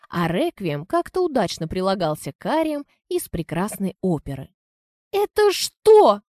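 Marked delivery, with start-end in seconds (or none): 5.1-6.2 high, slow, loud disbelief; shocked. Acted. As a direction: drag it out in shock. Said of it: "округлила глаза Анжелка".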